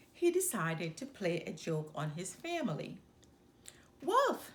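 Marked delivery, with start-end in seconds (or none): 4.0-4.5 high, loud cry of wolf; a boy's loud alarm call; cried out. A narrator's reading. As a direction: shout it out to the village. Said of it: "he cried".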